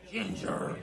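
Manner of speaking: voiceless, rough reproach to the dog; scolding grandma. Acted. Grandma looks down at the dog, scolding without full voice. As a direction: scold the dog under a harsh breath.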